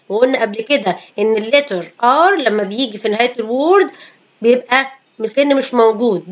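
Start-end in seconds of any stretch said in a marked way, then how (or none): none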